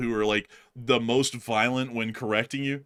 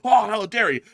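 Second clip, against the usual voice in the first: mock outrage; 10 semitones higher, 7 dB louder than usual. Imitating outraged people.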